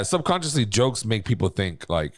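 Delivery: normal talking voice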